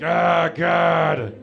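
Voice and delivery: loud mock "Oh, God" shout; hammed-up yell of dismay. Reacts to being told giant hands.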